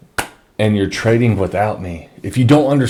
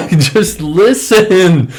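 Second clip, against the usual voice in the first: slow, high, laughing plea; laughing in disbelief; 11 semitones higher, 6 dB louder, much slower than usual. Real, not acted. Frustrated plea to followers.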